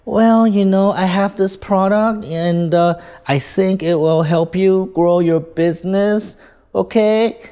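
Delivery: hesitant, drawn-out, unsure pitch; unconvincing salesperson. Shown as a demonstration. Salesperson pitching a product to a prospect, unconvincing. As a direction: feel your way through the pitch, doubtful. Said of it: "Do you think the prospect is gonna believe you? Probably not".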